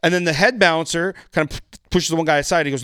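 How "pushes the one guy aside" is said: ordinary talk, nothing marked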